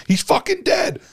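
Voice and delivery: very high-pitched mock alarm; shocked onlookers. People seeing him crumpled in a heap, high mock shock. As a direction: imitate a stunned crowd crying out, pitch shooting up. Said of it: "and people were like".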